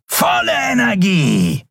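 high, slow roaring command; bellowed order. A narrator's reading. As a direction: roar the order across the field. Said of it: "brüllte der Schattenskrier".

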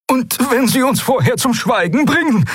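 very high, worried voice; anxious character. A narrator's reading. Frightened admiral fearing they will be silenced, anxious. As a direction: ask in a high, fretful voice. Fearful figure asks in the story.

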